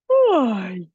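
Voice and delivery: a big, open, audible yawn; demo yawn. Shown as a demonstration. Coach shows viewers a yawn to relax the throat, big open yawn. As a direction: let out a full yawn to show them how. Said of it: "I want you to".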